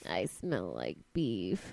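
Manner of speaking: very low, slow joke voice; mock-deep, dragging delivery; goofy drawn-out bit.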